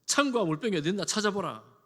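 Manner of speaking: loud, challenging order; forceful acted rebuke; scolding command. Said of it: "다윗이 호통을 치고 있습니다".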